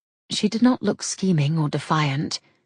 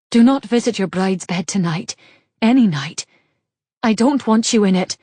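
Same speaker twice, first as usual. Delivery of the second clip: louder, firm refusal; mild angry refusal; 3 semitones higher, 7 dB louder than usual. Acted. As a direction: push him away with your voice. Constance rejects Saint.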